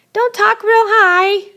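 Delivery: high voice held on one note; high-pitched. Shown as a demonstration. Teacher showing students what too high sounds like, high-pitched. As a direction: pitch it up high and keep it there. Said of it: "highness or lowness of a person's voice".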